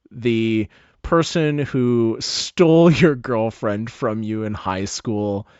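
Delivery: usual speaking voice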